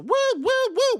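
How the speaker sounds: mock crowd woo. Showing what they did in break.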